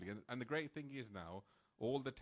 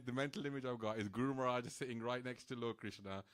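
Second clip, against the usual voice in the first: ordinary delivery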